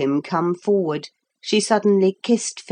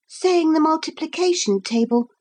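ordinary delivery, nothing marked

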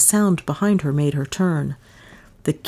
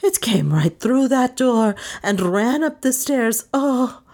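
high, worked-up frightened cry; frantic account; 7 semitones higher than usual. A narrator's reading. Maid still hopping, telling detective, frantic. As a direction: build toward a scream. Hysterical maid describes the ghost.

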